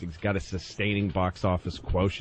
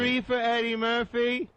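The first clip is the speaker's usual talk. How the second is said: higher, eager ticket buyer; mock moviegoer; 12 semitones higher than usual. Mocking a moviegoer at the ticket counter, eager voice. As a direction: play a clueless fan at the box office.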